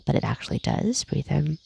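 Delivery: whispered "but it actually does", breathy and soft. Shown as a demonstration. Guide to the group, a knowing whisper.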